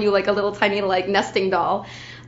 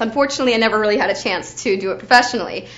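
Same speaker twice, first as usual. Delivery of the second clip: usual speaking voice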